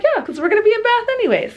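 "Yeah, 'cause we're gonna be in Bath anyways" squeaked very high and fast; eager high voice. Retold or imitated. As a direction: quote yourself squeaky and quick. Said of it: "I was like".

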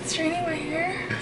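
ordinary delivery

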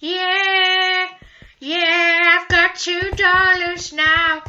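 high, loud triumphant crowing; gleeful high singsong boast; excited gloating. Real, not acted. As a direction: crow it loudly, stretching the words. Gloating about speed and money.

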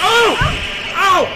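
sudden loud high ow of pain; real pain yelp. Real, not acted. Banging a toe on the desk during recording, real pained yelp. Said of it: "I banged my toe".

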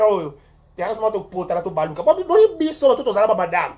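ordinary delivery

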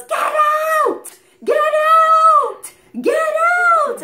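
high, loud parrot screech; imitated bird scream. Storyteller imitates the bird chasing her off, screamed squawk. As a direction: screech it like an angry parrot, words drawn out.

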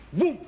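sudden loud burst of a fireball sound; loud mimicked woof of flames; whoosh of flames. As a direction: blurt the fire going up. Fire catching after the match.

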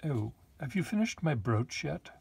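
high-pitched, swooping lady's voice; Lady Wentworth. Pretend.

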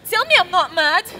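frantic plea to his sister; panicked plea. Acted. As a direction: beg to be told you are sane.

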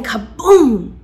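loud, slow blast of a word; booming kaboom. A narrator's reading. Narrator announcing the trapdoor crash, booming. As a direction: boom it out like a crash.

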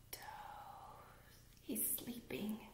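hushed sleepy whisper. Acted. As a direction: whisper so the flea can sleep. Whispering as the flea dozes.

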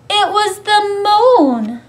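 high, loud storyteller's reveal; big joyful storybook moment; excited reveal. A narrator's reading. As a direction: announce it with delight. Narrator reveals the moon.

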